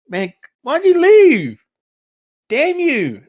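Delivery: high, loud hammed-up wail of betrayal; mock anguish. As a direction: cry out in fake heartbreak, dragging the words. Joke outburst as Rex, then "I'm kidding.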